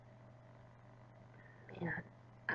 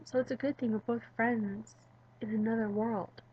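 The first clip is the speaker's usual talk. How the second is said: ordinary talk, nothing marked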